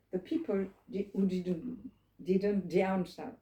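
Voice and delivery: ordinary talk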